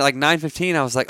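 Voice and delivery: usual speaking voice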